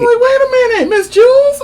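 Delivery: high, loud "wait a minute"; excited outburst. Retold or imitated. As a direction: shriek it up high in delight. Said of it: "I'm like".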